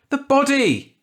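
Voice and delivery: a high, loud, frantic cry; panicked shout. A narrator's reading. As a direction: yell it at the crowd in terror. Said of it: "Ed shouted".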